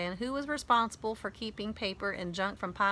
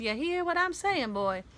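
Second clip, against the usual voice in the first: mock hillbilly; 7 semitones higher than usual. Kid echoes Dad's hillbilly bit.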